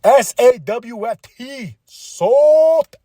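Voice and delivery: slow, high, loud taunting; sneering stable taunting; mock heel sneer. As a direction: spell it out to mock him. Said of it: "they're gonna be like".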